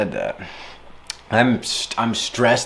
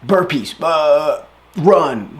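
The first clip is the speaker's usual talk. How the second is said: blunt mock order; 6 semitones higher, 7 dB louder, much slower than usual. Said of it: "you're gonna be like".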